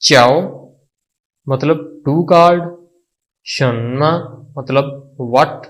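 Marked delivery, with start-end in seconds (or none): 0.0-0.4 चाओ loud and isolated, for repetition; pronunciation demo. Shown as a demonstration. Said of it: "इनको बोलने का तरीका". 3.4-4.1 शन्मा drawn out, ending on a rise; pronunciation demo. Shown as a demonstration. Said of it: "इनको बोलने का तरीका".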